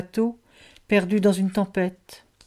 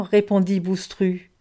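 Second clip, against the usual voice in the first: usual speaking voice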